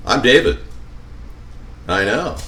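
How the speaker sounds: normal talking voice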